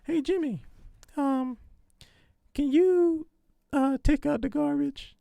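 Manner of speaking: high falsetto wife voice; man imitating a woman in falsetto; lady's voice. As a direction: put on a squeaky-high lady's voice. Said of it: "let me do a lady's voice".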